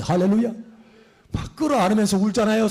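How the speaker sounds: usual speaking voice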